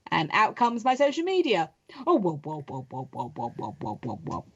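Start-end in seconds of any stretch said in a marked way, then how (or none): 2.0-4.4 whoas sinking much lower and softer; falling whoa effect. Pretend. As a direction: slide down through the whoas. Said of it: "it always needs a da-da".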